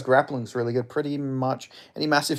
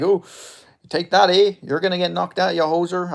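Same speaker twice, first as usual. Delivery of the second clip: hammed-up Canadian accent, louder; playful Canadian accent with eh; 6 semitones higher, 5 dB louder than usual. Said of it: "I was doing a Canadian accent".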